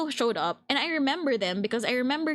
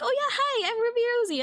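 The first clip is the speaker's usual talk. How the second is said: chirpy hello; 10 semitones higher, faster than usual. Retold or imitated. Retold bright self-introduction.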